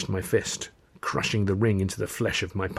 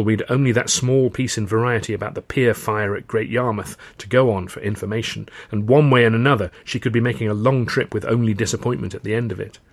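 ordinary delivery, nothing marked

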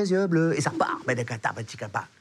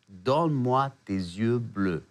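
Donne-moi tes yeux bleus drawn out slowly; slow repeated line; 4 semitones lower, much slower than usual. Retold or imitated. Musician trying the hook again, slow drawn-out chant. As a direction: stretch every word, musing. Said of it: "Il le dit quatre-vingt-cinq fois".

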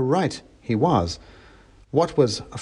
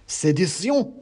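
mock revolutionary shout; 7 semitones higher, slower than usual. A narrator's reading. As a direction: exclaim it as the mouse in the hat. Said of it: "shouted the mouse in the hat".

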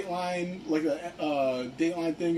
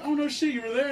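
mock surprise, pitched much higher; surprised; 9 semitones higher, faster than usual. Glover hearing a fan saw his show, surprised. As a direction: imitate a star caught off guard, higher.

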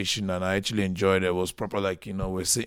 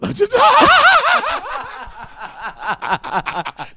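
a loud high shriek of laughter; 10 dB louder than usual. Real, not acted. Laughs at own joke.

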